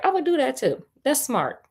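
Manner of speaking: plain reading voice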